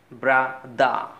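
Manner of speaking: ব্রাদা with the first r sounded, final r dropped; British pronunciation. Shown as a demonstration. Teacher giving English for brother, ব্রাদা without final r. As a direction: say ব্রাদা slowly, open "dah" at the end. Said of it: "ওই আরটা কিন্তু আমি বলেছি".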